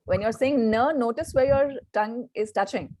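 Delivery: ordinary delivery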